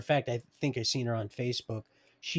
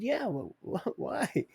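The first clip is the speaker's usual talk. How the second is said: laughing stammer; 10 semitones higher, 3 dB quieter, slower than usual. Retold or imitated. Shocked by buddy's answer.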